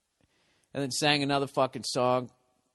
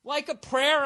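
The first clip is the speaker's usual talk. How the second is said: much higher, disbelieving outburst; can't believe what he's seeing; incredulous. Retold or imitated. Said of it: "at first, I'm like".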